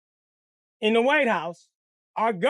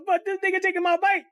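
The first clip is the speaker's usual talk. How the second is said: mock crying; 10 semitones higher than usual. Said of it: "I'm crying like a little bitch".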